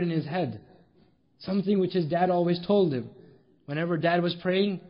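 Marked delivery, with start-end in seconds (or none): none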